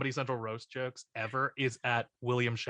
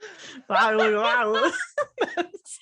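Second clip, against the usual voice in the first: loud laughing fit; 8 semitones higher, 6 dB louder than usual. Real, not acted. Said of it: "that's the part that I love".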